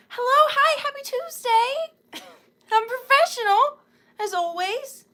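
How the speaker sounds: bright put-on welcome voice; high sing-song greeting. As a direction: greet the chat in a cartoonish high voice. Live stream greeting, exaggerated voice.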